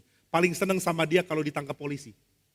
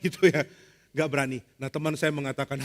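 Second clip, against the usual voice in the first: normal talking voice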